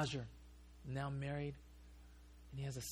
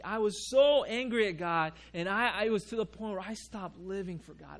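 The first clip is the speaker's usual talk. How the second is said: louder and higher, the widow's anger at God rising; angry outburst; 4 semitones higher, 10 dB louder than usual. Retold or imitated. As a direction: let the betrayal burst out loud.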